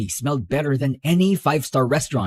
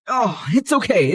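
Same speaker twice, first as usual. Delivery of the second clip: ordinary talk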